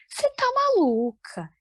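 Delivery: pai incrédulo, mais agudo e lento; incredulous father. Retold or imitated. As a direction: imita o pai achando que ela enlouqueceu. Pai reage à ideia da filha. (pai espantado com a filha, voz aguda e arrastada)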